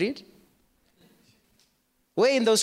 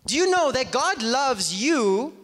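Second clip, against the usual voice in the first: usual speaking voice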